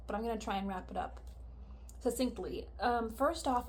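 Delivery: normal talking voice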